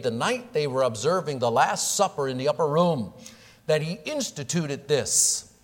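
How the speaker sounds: full-force sermon build; preaching. Acted. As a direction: drive it home to the congregation.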